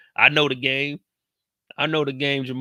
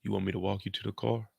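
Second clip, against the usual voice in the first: soft, lightly lowered smooth-talk; 3 semitones lower, 8 dB quieter than usual. Retold or imitated. Jamal offering to walk Tracy out, lowered voice. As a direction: drop your voice, imitating a man making his move. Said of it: "He lowered that voice a little bit".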